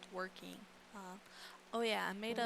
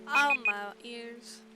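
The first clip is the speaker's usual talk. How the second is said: loud; 3 semitones higher, 11 dB louder, slower than usual. Real, not acted. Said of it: "a lot louder than I expected".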